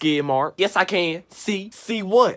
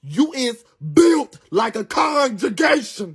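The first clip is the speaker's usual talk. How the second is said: drawn-out roast build-up; 2 semitones higher, slower than usual. Acted.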